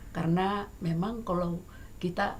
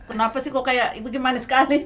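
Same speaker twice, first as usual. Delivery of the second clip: raised, louder imitation of a puzzled reaction; mock questioning; 6 semitones higher, 7 dB louder than usual. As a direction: mimic someone querying an odd word, voice lifted. Mimics others reacting to her wording.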